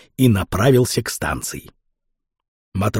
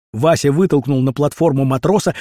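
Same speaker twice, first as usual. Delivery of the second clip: ordinary delivery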